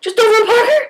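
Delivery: imitating his pleasantly surprised reply; surprised echo. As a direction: echo it back as an interested question. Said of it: "He's like".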